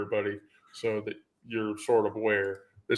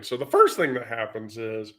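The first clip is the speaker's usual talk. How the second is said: ordinary delivery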